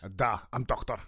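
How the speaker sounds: low, soft mock Russian accent; hammed-up Russian accent; Russian doctor. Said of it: "Is this the doctor's office?".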